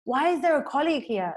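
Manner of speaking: mock indignant complaint; annoyed. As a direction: act out being put out by an intrusion. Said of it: "he feels quite annoyed".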